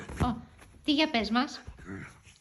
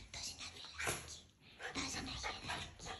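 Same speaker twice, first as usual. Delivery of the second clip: soft whispered coaxing; 17 dB quieter than usual. Real, not acted. Owner whispering to her dog for a kiss, softer. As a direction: breathe it, no voice at all.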